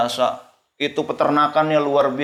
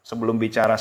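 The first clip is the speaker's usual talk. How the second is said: ordinary talk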